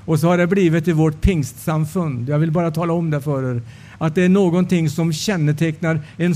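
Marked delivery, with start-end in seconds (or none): none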